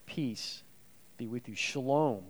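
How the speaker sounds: soft, tender blessing; gentle blessing. Acted. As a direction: lay it down softly like a comfort. Preacher softly voices Jesus' blessing.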